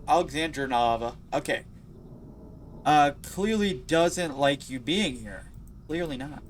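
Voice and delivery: ordinary talk, nothing marked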